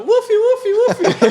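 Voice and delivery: very high, loud imitation yelp; mock squealed safe word. Imitating him yelling the safe word under hot wax, squealed. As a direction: squeal it through laughter. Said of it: "and he's going".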